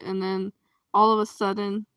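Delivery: ordinary talk, nothing marked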